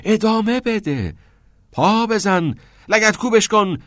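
high, loud, punched-out phrases; rousing urging. A narrator's reading. Teacher drives pupil's poem forward, rousing urging. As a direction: spur the poem onward.

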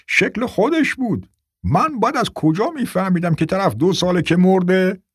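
put-on high, rambling old man. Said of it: "پیرمرد گفت".

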